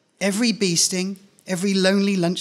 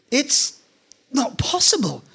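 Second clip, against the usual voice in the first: disbelief; 7 semitones higher, slower than usual. Acted. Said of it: "I say again".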